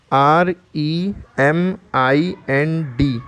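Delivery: slow letter-by-letter spelling. Shown as a demonstration. Teacher spells a new word for learners, slow spelling. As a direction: spell it slowly, each letter held apart.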